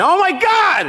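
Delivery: high shocked gasp of a line; shocked cry. Retold or imitated. Owner seeing chocolate on dog's face, shocked high cry. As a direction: yelp it out in alarm. Finds dog ate chocolate.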